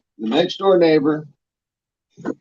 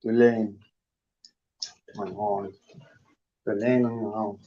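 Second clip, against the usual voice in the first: quiet scolding; 4 semitones lower, 7 dB quieter, slower than usual. Real, not acted. Scolding pet off mic.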